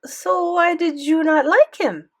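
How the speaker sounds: put-on high, loud, slow French-accented question; pointed question in a French accent; French-accented grandmother. A narrator's reading. Grandmother pressing grandson for reasons, French accent. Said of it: "Grandmere had a thick French accent".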